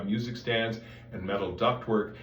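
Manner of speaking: usual speaking voice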